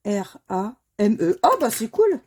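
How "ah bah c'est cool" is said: pleased, bright exclamation; real delight at a win; a happy little cry of success; excited. Real, not acted. Delighted at a winning word.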